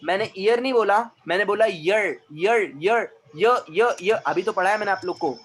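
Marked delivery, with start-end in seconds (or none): 2.3-4.2 teacher drills "य" as a clear y sound, loud. Shown as a demonstration. Teacher drills class on the y sound, firm. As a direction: repeat "य" firmly, again and again. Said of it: "य का sound आएगा".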